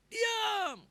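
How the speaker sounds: high, loud shout to silence someone; irritated crowd's yell; shushing crowd. Acted. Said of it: "dia bilang gini".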